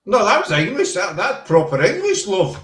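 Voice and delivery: high, hammed-up southern English accent; mock English accent. Shown as a demonstration. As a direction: play up a proper down-south English voice. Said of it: "That proper down south the border".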